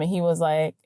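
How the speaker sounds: ordinary talk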